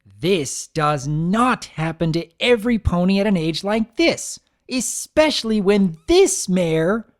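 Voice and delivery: calm cracking into an outburst; losing her temper. Acted. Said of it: "but it didn't last".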